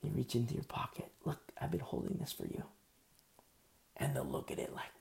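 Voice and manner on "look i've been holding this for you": softly whispered, like a secret gift; secretive whisper. Acted. Believer quietly offers the convert hidden pearls, tender whisper.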